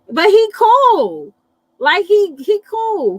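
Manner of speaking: high, loud and drawn out; emphatic praise. Real, not acted. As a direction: really lean into how cool he is.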